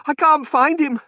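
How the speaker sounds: high, loud, panicked cry; frantic. A narrator's reading. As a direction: cry out while digging desperately. Digging frantically through bricks.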